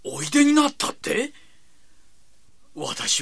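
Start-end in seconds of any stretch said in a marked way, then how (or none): 0.0-1.3 high incredulous question; can't believe he came; surprised. Acted. Disbelief at Jesus' visit.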